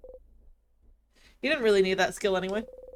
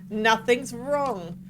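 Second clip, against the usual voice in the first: forced slow denial; 2 semitones higher, much slower than usual. Acted.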